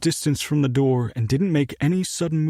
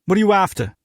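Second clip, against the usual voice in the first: put-on British accent; 6 semitones higher, 4 dB louder, faster than usual. British owner questioning.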